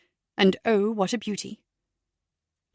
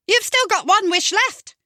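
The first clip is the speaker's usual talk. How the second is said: high, louder fairy godmother voice; fluting fairy godmother; 11 semitones higher, 5 dB louder than usual. Acted. Comedian voicing the fairy, high and louder.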